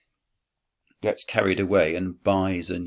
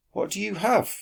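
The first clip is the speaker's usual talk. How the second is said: much higher, questioning voice of Quintus; high character voice; 14 semitones higher than usual. Acted. Teacher voicing Quintus, high and questioning. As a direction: lift the voice high for the boy's question.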